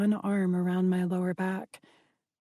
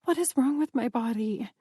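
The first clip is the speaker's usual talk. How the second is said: plain reading voice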